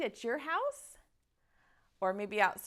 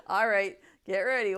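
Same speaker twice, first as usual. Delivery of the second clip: laughing; 2 semitones lower, 8 dB louder than usual. Real, not acted.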